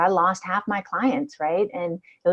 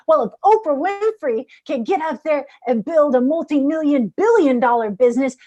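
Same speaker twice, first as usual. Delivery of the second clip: higher and louder, rising with conviction; confident affirmation; 8 semitones higher, 4 dB louder than usual. Retold or imitated. Speaker quoting her affirmation, proud and higher. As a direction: declare it like a triumph. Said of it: "my statement of working with Jarvis was".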